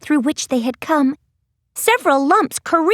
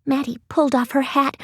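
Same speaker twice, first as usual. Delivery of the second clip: usual speaking voice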